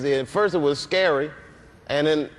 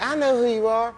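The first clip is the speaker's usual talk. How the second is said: imitating [name]; 9 semitones higher than usual. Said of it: "And she was like".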